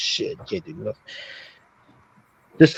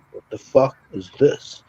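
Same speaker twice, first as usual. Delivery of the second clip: ordinary talk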